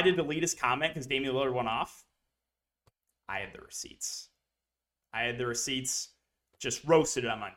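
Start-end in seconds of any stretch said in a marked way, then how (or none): 3.3-4.3 whispered, smug aside; hushed gloat. Real, not acted. Streamer whispers to viewers about having receipts, smug. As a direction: drop to a secret whisper to gloat.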